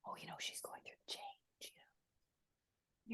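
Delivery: hushed, secretive whisper; older women gossiping in a whisper. Retold or imitated. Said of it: "whispers of stuff".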